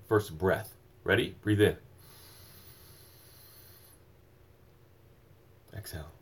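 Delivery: soft, low coaching through a deep breath; guided breathing. Real, not acted. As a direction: hush down and lead the inhale. Leading a breathing exercise.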